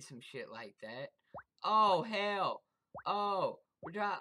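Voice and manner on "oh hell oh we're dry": loud, high cry of alarm; alarmed yell. Real, not acted. Gamer spotting danger, loud alarmed yell. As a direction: shout in sudden fright.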